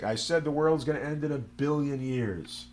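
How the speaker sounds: hint of a lecturer restating his point; scientist repeating himself. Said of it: "He's like".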